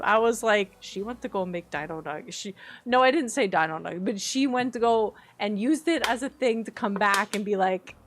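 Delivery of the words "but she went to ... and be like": ordinary talk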